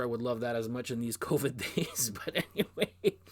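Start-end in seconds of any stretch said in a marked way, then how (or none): 2.1-3.2 laughing through the words, higher; real chuckle while moving on. Real, not acted. Vlogger laughs off his sweat-covered concert story, laughing. Laughs after joke about covid days.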